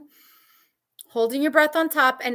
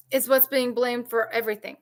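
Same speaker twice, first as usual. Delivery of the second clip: usual speaking voice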